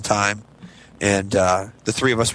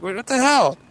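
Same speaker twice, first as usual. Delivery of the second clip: shocked exclaim; 10 semitones higher than usual. Retold or imitated. Continued panic.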